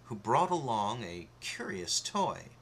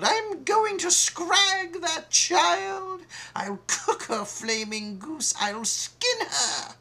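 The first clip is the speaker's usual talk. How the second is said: far higher, loud, slow enraged queen; furious yell; 7 dB louder, slower than usual. Acted. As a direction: snarl out murderous threats. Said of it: "She yelled".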